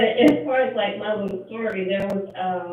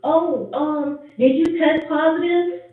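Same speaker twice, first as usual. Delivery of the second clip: sing-song mock concern; 8 semitones higher, 4 dB louder, slower than usual.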